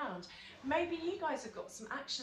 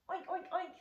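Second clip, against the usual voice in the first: pig oink; 5 semitones higher, 4 dB quieter, faster than usual. Shown as a demonstration. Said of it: "little piggy".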